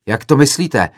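loud, high, upset retort; worked-up student shouting back; agitated yell. A narrator's reading. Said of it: "zařval rozčileně jiný student".

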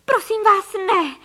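high, loud pleading scream; desperate cry. A narrator's reading. Mother begging armed ducks, desperate cry. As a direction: beg loudly for mercy. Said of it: "vykřikla paní Frejková".

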